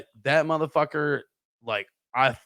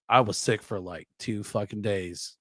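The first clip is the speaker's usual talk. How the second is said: ordinary delivery, nothing marked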